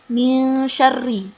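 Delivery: Min drawn out slowly with a blurred n; ikhfa hold. Shown as a demonstration. Teacher models holding the hidden nun. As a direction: hum min a moment before syarri. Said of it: "agak ditahan sebentar".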